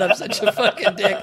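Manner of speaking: ordinary delivery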